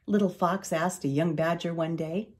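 ordinary delivery

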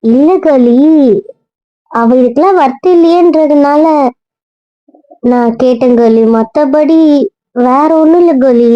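high-pitched, placating explanation; apologetic explaining. Acted. Hastily excusing question to friend.

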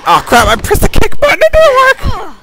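very high, loud squeal; shrieky gamer protest; panicked complaint. Real, not acted. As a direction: squeal in frustration over a failed move. Game button fails in attack.

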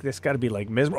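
normal talking voice

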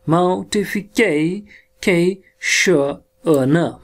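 slowly sounding out मॉर्टिफिकेशन sound by sound; syllable by syllable. Shown as a demonstration. Teacher to class, breaking the word into sounds slowly. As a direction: spell मॉर्टिफिकेशन out in separate slow sounds.

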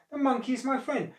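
high-pitched dismayed objection; appalled protest. A narrator's reading. Crocodile defending his friend to his wife, appalled. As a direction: protest in shock, voice shooting up. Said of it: "quite appalled".

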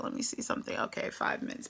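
unvoiced muttered aside to herself; whispered aside. Real, not acted. Vlogger checking her time mid-video, whispered aside. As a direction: whisper it off-mic.

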